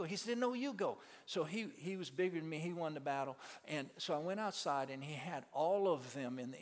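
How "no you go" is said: raised, stubborn no; insisting back. Retold or imitated. Friend refusing back to the preacher, high and insistent. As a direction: toss it right back at him.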